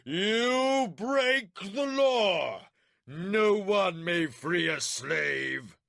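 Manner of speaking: very slow, high, loud scream; screaming rage. A narrator's reading. Warlord screaming at Milimber, enraged. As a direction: rage pouring out. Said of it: "the warlord screamed his rage".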